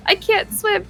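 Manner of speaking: high quote through laughter; laughing quote. Retold or imitated. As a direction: giggle while quoting the kid. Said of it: "She goes".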